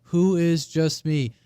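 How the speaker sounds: normal talking voice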